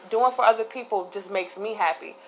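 normal talking voice

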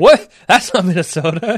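overplayed Boston indignation; Boston accent done for laughs; mock Boston accent. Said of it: "like a really strong Boston".

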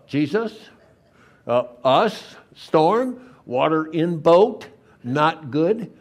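mock-worried, one fragment at a time; disciples' alarm. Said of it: "the disciples".

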